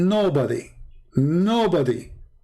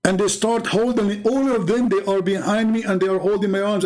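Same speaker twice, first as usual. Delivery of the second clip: higher, animated storytelling; excited retelling; 6 semitones higher than usual. Real, not acted. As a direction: relive the panic with the wolves. Narrating panic, voice raised.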